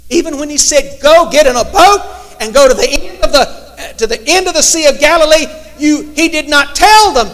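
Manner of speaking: loud, high, driving preacher's cry; full-force preaching. Acted.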